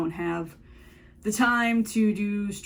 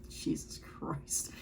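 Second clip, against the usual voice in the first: whispering; 9 dB quieter, much slower than usual. Real, not acted. Exasperated aside.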